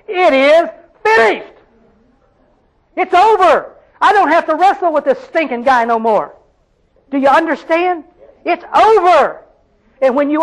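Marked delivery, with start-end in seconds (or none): 0.1-6.3 high, triumphant outcry; crying out. Acted. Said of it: "you're gonna cry out in your life". 8.4-9.4 high, emphatic outcry; crying out. Acted. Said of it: "you're gonna cry out in your life".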